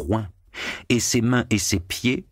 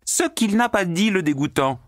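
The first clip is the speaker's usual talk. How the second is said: very high, gleeful shriek; screeching with laughter; 13 semitones higher than usual. A narrator's reading. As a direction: shriek out the scandal. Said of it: "s'égosillait Natacha en riant".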